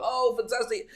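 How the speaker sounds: high-pitched gushing customer; acted delight. Customer enjoying pumpkin latte, acted delight. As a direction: gush it high, hammed up.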